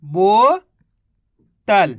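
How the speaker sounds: very slow, stretching every sound of बोतल; very slowly joining the syllables of बोतल; slow drawn-out blend. Shown as a demonstration. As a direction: stretch बोतल out so kids hear it join up. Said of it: "मिलके बन गया".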